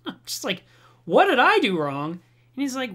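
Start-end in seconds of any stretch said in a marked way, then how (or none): 1.1-2.1 loud, indignant echoed question; incredulous. Retold or imitated. Answering the vice president's accusation, loud disbelief. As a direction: throw it back in disbelief.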